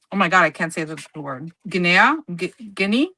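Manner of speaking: ordinary talk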